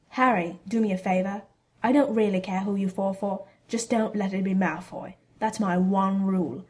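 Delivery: ordinary delivery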